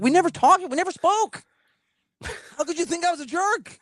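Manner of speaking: high, indignant disbelief; incredulous protest. Retold or imitated. Telling his producer they never spoke, high and incredulous.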